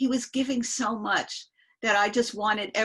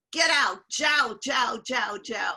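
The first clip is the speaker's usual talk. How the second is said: loud, drawn-out mock dismissal; retold yelling; 2 semitones higher, 6 dB louder, slower than usual. Guru ordering the smoker out, retold yelling. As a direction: imitate the guru shouting someone away. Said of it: "he started yelling at him".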